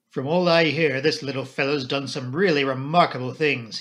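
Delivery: ordinary delivery, nothing marked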